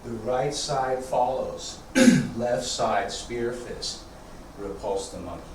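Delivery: quiet unhurried cues, guiding the form; serene tai chi cues. Acted.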